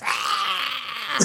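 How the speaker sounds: mock pained shriek. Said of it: "he goes".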